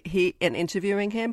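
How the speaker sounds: usual speaking voice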